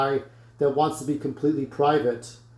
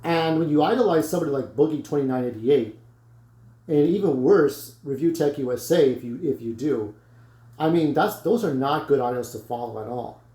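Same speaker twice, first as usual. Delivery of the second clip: ordinary delivery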